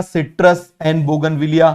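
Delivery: very slow, word by word for note taking; slow dictation. Shown as a demonstration. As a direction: spell it out slowly so students can write. Teacher dictates examples slowly.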